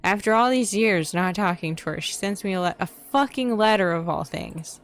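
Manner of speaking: building from annoyed to a loud furious rant; angry rant. Acted. Venting to a friend about the sister's letter, angry and building. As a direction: let the frustration boil over.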